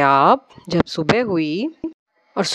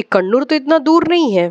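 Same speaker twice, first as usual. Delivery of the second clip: raised, higher voice calling out; loud call; 7 semitones higher than usual. Retold or imitated. A voice from behind, loud and high. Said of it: "ज़ोर से बोल रहे हैं".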